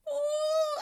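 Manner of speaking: a high cringing ooh. Real, not acted. Reacting to the ashy ankles, a high cringing ooh.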